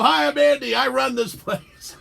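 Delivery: high, loud, slow smug manager voice; mock boss greeting. Guest imitating the station manager greeting him, loud and high. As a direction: play the proud station manager.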